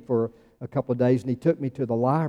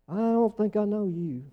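puzzled non-recognition; 7 semitones higher, 4 dB quieter than usual. Acted. Master turning away a caller, high puzzled disowning. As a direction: draw it out as if failing to place them.

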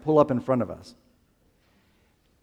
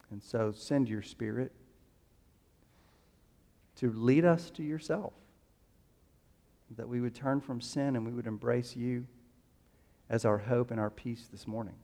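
prayer; 5 semitones lower, 11 dB quieter than usual. Real, not acted. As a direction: pray it quietly with long pauses. Leads congregation in prayer.

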